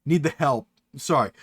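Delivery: usual speaking voice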